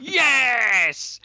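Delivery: high, loud victory cry; triumphant yes. Real, not acted. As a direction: cheer as if you won. Triumph at wife singing it right.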